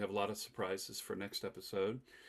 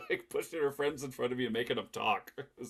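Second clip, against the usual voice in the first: usual speaking voice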